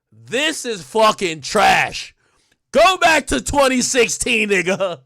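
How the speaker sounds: slow, loud dismissive yell; mock fan shout. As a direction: bellow it like a hater in the crowd. Fans trashing Uzi.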